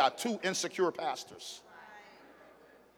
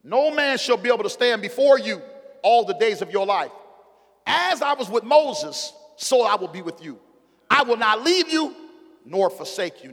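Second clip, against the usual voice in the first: loud, high preacher's cry of God's words; booming pulpit proclamation; full-force preaching; 7 semitones higher, 7 dB louder than usual. Acted. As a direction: preach it out, rising and ringing.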